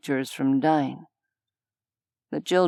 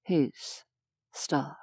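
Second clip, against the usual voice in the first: much softer, hushed repeat of star; soft echo; 8 dB quieter than usual. A narrator's reading. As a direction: murmur it like a private thought. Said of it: "a star".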